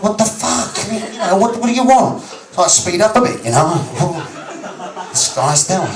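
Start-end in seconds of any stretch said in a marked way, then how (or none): 0.0-2.2 mock indignant. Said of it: "I'm like".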